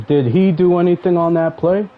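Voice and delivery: shouting the question at the opponent; loud rant. Real, not acted. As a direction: call him out loudly, pitch way up. Angry rant at online opponent.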